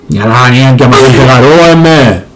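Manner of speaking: gruff low mumble; low comic voice. Acted. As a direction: drop into a deep silly voice.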